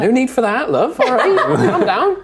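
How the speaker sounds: a man calming the dwarven woman, mock-gentle; placating human man. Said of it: "He's like".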